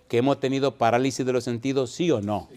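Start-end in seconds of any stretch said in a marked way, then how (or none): none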